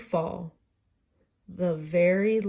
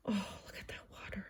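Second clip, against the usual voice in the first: whisper; 16 dB quieter, faster than usual. Real, not acted. Parent whispering to a child over the picture book, fast hush. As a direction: lean in and whisper it quickly.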